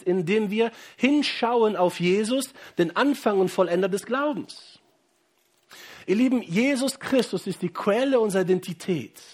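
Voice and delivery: fervent preaching. Acted. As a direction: preach it out to the whole church.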